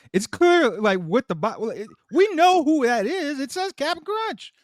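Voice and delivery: high-pitched incredulous rant; high exasperated rant about the boxes; excited rant. Real, not acted. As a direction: argue the point, pitch climbing.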